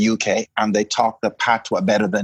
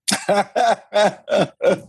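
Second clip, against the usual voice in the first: amused laugh; 10 semitones higher than usual. Real, not acted. Laughs after her own remark.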